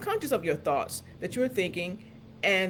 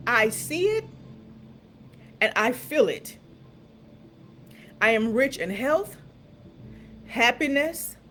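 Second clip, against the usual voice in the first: higher, louder, rising declaration of each blessing; emphatic declaration; 4 semitones higher, 4 dB louder, slower than usual. Shown as a demonstration. Coach repeating the affirmation with bold conviction. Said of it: "And as you are declaring it".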